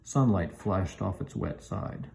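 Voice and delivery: usual speaking voice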